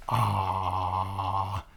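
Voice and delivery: ordinary delivery, nothing marked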